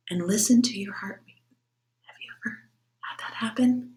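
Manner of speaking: put-on soft whisper to children. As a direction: hush it like a secret. Talking about stethoscope to kids.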